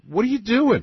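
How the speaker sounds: high, loud disbelief; what are you doing, said in shock; incredulous. Retold or imitated. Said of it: "And I'm thinking".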